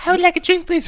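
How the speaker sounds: squeaky little voice. Pretend. Acting out a tiny person.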